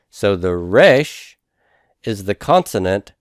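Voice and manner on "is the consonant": usual speaking voice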